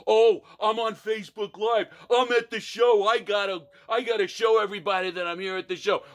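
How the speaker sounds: a lightly voiced show-off bragging about going live; mocking phone filmer. Mocking the concertgoer who must stream the show, lightly voiced show-off. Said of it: "Why is it that everybody has to take video".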